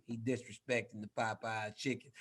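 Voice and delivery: low Southern drawl impression; deep Southern drawl. Imitating rival Derek's complaint, deep Southern drawl. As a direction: drop down into a deep Southern accent. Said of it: "in his deep Southern drawl".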